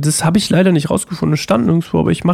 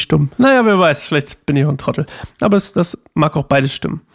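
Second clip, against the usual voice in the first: ordinary talk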